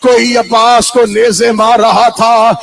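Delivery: loud, building cry of lament; impassioned majlis preaching. Acted. Preacher tells mourners of Abbas falling, anguished full-force declamation. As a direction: drive the tragedy home at full voice.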